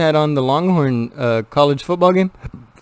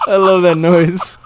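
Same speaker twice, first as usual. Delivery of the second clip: laughing; 8 semitones higher, slower than usual. Real, not acted. Laughing at the noise.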